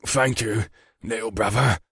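understated catch in the captain's voice; touched captain, only lightly choked. A narrator's reading. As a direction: thank him with a slight catch. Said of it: "with a catch in his voice".

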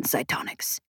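dropping to a near whisper; softly. A narrator's reading. Man marvels at ancient cytonics, trailing off softly. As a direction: let the word fall away, low and hushed. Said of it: "he said softly".

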